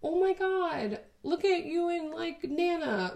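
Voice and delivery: put-on high, excited kid gushing over photos. Future kids gushing over old wedding photos, high and excited. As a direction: gush like a thrilled child. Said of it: "they'll be like".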